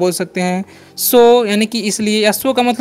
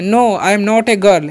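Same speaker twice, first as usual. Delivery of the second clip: slow example sentence; much slower than usual. Shown as a demonstration. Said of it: "इस तरीके से जो है नो का प्रयोग".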